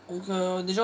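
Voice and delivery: ordinary delivery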